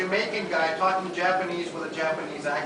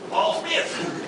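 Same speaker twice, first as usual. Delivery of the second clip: put-on French accent; 5 semitones higher, 3 dB louder than usual. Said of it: "they call them".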